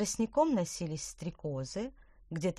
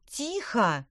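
sharp angry shout; 5 semitones higher, 5 dB louder than usual. Acted. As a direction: shout for silence. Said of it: "крикнула Петронелла".

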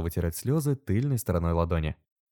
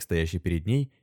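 plain reading voice

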